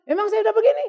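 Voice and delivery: high, loud and defiant, arguing back; a loud, stubborn "Emang saya udah begini?"; insistent retort. Retold or imitated. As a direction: push back hard as if insulted. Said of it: "sambil ngotot pula lagi ngomong".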